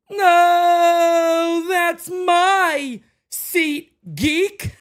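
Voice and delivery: high, loud put-on voice, dragged out slow; ad skit voice. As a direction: ham up the ad tagline as a character. Ad skit punchline voice.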